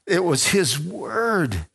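high, choked-up testimony; moved almost to tears; emotional. Real, not acted. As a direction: let the voice tremble with feeling. Emotional testimony after laugh.

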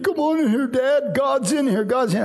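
a son in tears calling his dad in; imitating crying son. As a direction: choke it out like an overwhelmed teenager. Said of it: "he's crying".

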